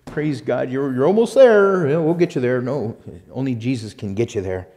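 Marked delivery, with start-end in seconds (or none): 0.1-2.6 flattering preacher. Retold or imitated. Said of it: "butter you up and say".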